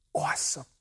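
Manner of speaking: very soft, near-whispered answer; hushed reply. Retold or imitated. John replying about his shrinking following, hushed. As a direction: breathe it out quietly. John's understated reply in acted dialogue.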